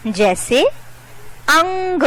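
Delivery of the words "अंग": अंग with the ं hummed through the nose, held high; nasal anusvara demo. Shown as a demonstration. Teacher showing children the nose sound, nasal anusvara in अंग. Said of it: "नाक से ध्वनि निकलती है".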